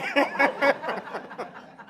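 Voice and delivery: long, high laugh; amused laugh. Real, not acted. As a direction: laugh heartily at your own story. Laughs at her own story.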